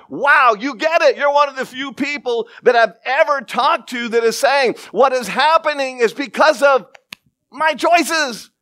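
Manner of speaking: high, loud, astonished; amazed. Real, not acted. Preacher marveling at the lady's words, high and loud. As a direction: ring out in wonder.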